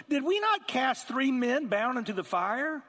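hammed-up astonished king. King asking his counselors in astonishment. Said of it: "was astonished".

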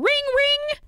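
high sung ring ring, like a phone; ringing phone sound. Pretend. Host playing the phone ringing for the sketch, mock ring. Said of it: "Okay, here we go".

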